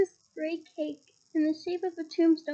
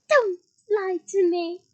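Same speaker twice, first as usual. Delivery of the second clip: loud slow tearful cry; gasped outburst; 4 semitones higher, 10 dB louder, slower than usual. Acted. A tearful ghost girl shouts at Hermione, loud. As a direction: burst out hurt and loud. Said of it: "Myrtle gasped".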